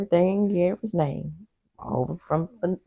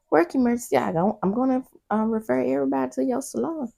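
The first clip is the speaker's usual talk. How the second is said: hurried imitated excuse; 8 semitones higher, faster than usual. Voicing Annie rushing out.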